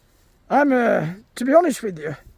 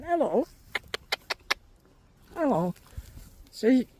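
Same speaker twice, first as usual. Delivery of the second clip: ordinary talk, nothing marked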